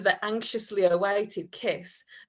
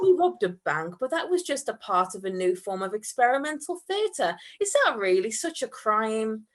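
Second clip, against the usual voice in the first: usual speaking voice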